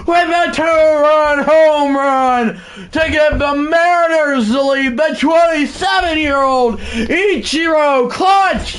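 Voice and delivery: high, shouted broadcaster call, stretching the name; home run call. Acted. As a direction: bring the house down like a TV announcer calling the walk-off.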